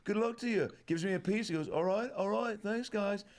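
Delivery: mock rock star signing off, sing-song.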